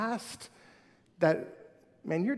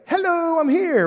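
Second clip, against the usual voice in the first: loud, high mock greeting; higher and louder hammed-up arrival; calling out; 10 semitones higher, 10 dB louder than usual. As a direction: barge in with a bright loud hello. Acting out butting into circle.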